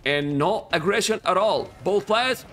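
lifted, energetic esports commentary; casting voice. Real, not acted. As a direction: call the game like a hyped caster. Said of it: "I changed my voice for casting".

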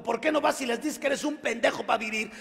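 mock a mother's tirade; angry mother. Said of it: "se fue bien recio".

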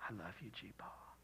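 put-on soft whispered quote of a little girl; child's whisper. Pastor quoting a little girl to the congregation, whispered. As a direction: breathe it like a small child's secret. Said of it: "That little girl say".

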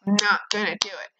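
a quiet promise to herself not to be petty; quiet resolve. Real, not acted. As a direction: repeat it under your breath. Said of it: "I'm not gonna be petty as him".

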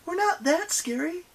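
high, put-on heroine's protest; crying out. A narrator's reading. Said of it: "she cried".

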